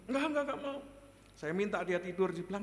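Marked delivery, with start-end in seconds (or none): 0.1-0.8 high-pitched mock refusal; imitating defiant child. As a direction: play a scared but defiant child. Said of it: "Tapi sambil melotot juga". (menirukan anak takut tapi melawan)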